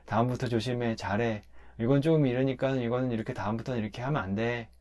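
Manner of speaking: a hint of an unruffled top boss; quiet mob boss. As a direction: play a boss who doesn't raise his voice, lightly. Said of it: "소리도 크게 안 내요".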